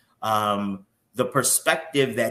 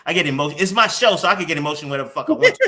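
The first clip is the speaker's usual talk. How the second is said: loud, higher-pitched self-defence; playfully defiant; 4 semitones higher, 7 dB louder, much faster than usual. Real, not acted. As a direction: assert it loudly, rushing to the end. Mock-defiant defence of getting emotional.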